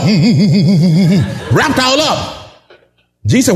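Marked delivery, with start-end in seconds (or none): 0.0-1.5 mock hums through burial wrappings; muffled mumbling. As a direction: hum like a mouth bound in linen. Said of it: "Trying to talk".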